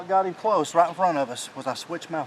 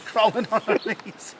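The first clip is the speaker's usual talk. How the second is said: higher, amused echo through a laugh; 5 semitones higher than usual. Real, not acted. Hunter echoing his partner about the hard walk, laughing. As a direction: laugh while saying it.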